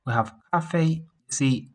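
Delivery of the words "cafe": "cafe" with both syllables high and level; high-pitched tones. Shown as a demonstration. Teacher showing the first tone, "cafe" high and level. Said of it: "they are all high-pitched tones".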